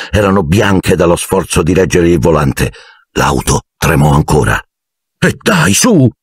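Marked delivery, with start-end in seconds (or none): 5.2-6.1 frustrated high cry; exasperated outburst. A narrator's reading. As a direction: burst out at the stalling car. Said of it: "sbottò Ron".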